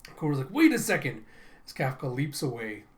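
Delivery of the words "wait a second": high, loud cry to stop; acted alarmed shout; urgent protest. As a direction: call out urgently after someone leaving. Kokoro calls after departing Kafka.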